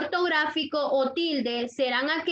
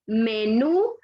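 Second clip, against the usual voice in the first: "menú" rising onto a strong "nú"; stress on last syllable; 3 semitones lower, 4 dB louder than usual. Shown as a demonstration. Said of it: "fuerza de voz en la última sílaba".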